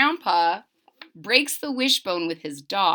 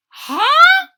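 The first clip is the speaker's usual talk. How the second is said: high startled huh; surprised huh; 12 dB louder than usual. Acted. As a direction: react startled.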